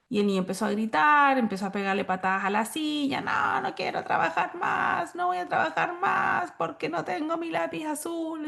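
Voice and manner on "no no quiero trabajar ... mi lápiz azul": put-on high, slow whining child voice; whiny child. Angry child refusing to work over his pencil, whiny and high. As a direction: whine like a kid who lost his pencil.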